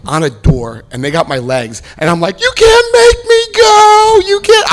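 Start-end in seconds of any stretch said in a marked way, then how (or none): 2.4-4.7 very high, slow, loud wail; hammed-up desperate resistance. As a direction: shriek like a child being dragged away, played for laughs. Dragged out clinging to door.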